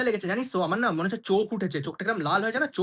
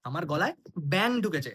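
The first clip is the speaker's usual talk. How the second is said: ordinary talk, nothing marked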